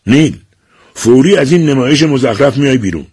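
furious father ordering him out; angry shout. A narrator's reading. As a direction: bark the order at his son. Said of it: "با پرخاش و فریاد گفت".

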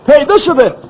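high, joyful cry of relief; elated shout. Acted. Deputy announcing the girl is found, elated shout. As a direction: shout the good news out.

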